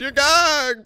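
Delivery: mocking high-pitched jab; high sing-song gagged. Real, not acted. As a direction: tease it up high, stretching gagged.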